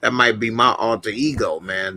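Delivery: ordinary talk, nothing marked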